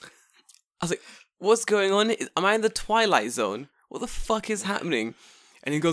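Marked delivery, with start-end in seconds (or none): 1.4-5.1 higher-pitched baffled outburst; mock-dramatic disbelief; bewildered. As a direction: retell utter confusion, voice raised. Retold bewilderment at stranger's lecture.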